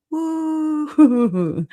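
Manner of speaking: be your own sound effect; celebratory woo. Pretend. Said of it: "I don't have any damn sound effects, or I would do it".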